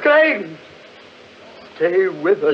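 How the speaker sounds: high, loud shout of the name; urgent shout. Acted. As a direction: yell to stop him running off. Calling after fleeing friend.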